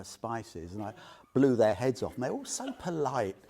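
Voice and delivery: usual speaking voice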